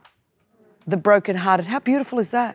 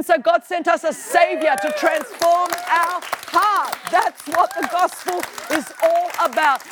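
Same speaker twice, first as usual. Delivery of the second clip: higher-pitched preacher cadence, building on each line; preaching at full force; 4 semitones higher than usual. Acted. Preacher driving the point home to the congregation, full force. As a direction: climb the list up to a ringing shout.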